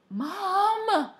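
long, high, loud protest; exasperated high-pitched cry to a parent; exclamation. A narrator's reading. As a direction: exclaim it, stretching the word. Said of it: "I exclaimed".

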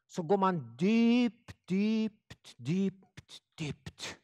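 slow, dreamy, fading to almost nothing; mock hypnotic guide. Preacher parodying meditation, slow hypnotic fade.

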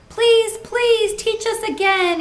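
put-on high, loud begging of children; eager pleading. A narrator's reading.

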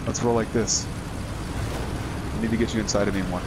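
normal talking voice

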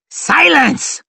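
high, forceful commanding roar. A narrator's reading. Lord marshal silences his officers, roar. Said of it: "roared Varus".

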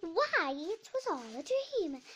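high, loud waking wonder; amazed realisation. A narrator's reading. Alice waking under the shade tree, amazed. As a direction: exclaim it with a swooping, astonished start.

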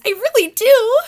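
high, loud eager bird voice; pigeon voice. Acted. Reader voicing the pigeon, high and eager. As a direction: pipe it up like an excited pigeon. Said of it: "And he says".

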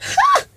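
shrill high ha; mocking ha. Real, not acted. Vlogger scoffing at the TV show title, shrill ha. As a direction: bark out a high ha.

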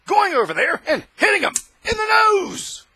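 building to a high, loud cry; rising shout. Real, not acted. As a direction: climb into a strained yelling peak. Heated rant about WWII.

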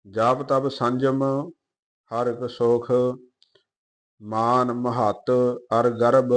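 slow, measured Gurbani reading; even, deliberate verse reading; scripture recitation. Acted. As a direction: recite line by line with drawn-out vowels.

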